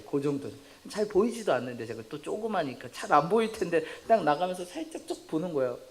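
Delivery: ordinary talk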